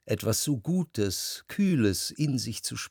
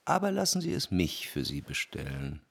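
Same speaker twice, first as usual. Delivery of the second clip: lower, softer address; 7 semitones lower, 6 dB quieter than usual. Acted. Narrator addresses his dear Albertine, lower and softer. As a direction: lower and softer, gently courting.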